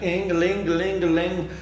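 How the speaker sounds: high sung ding-a-ling of a till; playful imitation of a till ringing up; cash register ring. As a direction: chime it like a shop register. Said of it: "too easy for me to be like".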